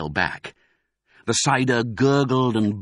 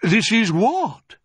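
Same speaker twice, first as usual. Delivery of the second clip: high, stretched-out query, heavy on WHAT; puzzled surprise; 13 semitones higher, 5 dB louder, slower than usual. A narrator's reading. Badger sees an empty cellar, baffled. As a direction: question it, drawing out "what".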